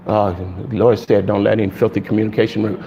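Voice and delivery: a quiet, low believer quoting scripture. Retold or imitated. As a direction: drop the voice to quote the believer.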